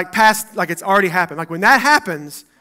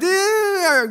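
mock God; 10 semitones higher than usual. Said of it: "And God's like".

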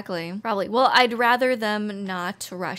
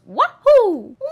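high loud Mario cheer "Wahoo!"; Mario's wahoo; 9 semitones higher, 12 dB louder, slower than usual. Retold or imitated. Explaining Martinet's voice, loud Mario "Wahoo!". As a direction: shout "Wahoo!" like Mario jumping. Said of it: "The one that goes".

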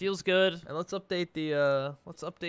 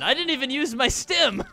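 laughing; 10 semitones higher, 5 dB louder than usual. Real, not acted. Laughing excitedly.

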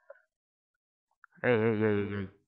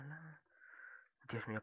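ordinary talk, nothing marked

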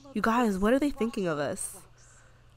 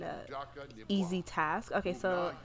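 ordinary talk, nothing marked

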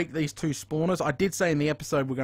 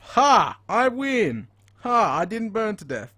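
triumphant gloat; 7 semitones higher, 7 dB louder, slower than usual. Real, not acted. Survives lava, gloats.

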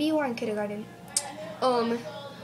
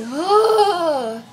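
loud, high, drawn-out mock groan; exasperated groan; 5 semitones higher, 10 dB louder than usual. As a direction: let out a big groan of pretend despair. Teacher despairing at class.

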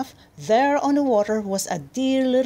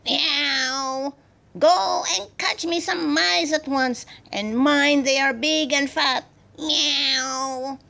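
cross cat, high and meowing; Missus Ginger; 5 semitones higher, slower than usual. A narrator's reading. Mother cat ordering the girl to catch mice, cross cat voice. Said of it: "grew very cross and growled".

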